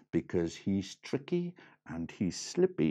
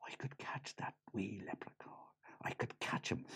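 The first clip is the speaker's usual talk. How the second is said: Lily's thought in a whisper; a girl whispering her plan to herself; 14 dB quieter than usual. A narrator's reading. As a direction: breathe it like a secret plan. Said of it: "she thought".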